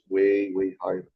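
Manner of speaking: ordinary talk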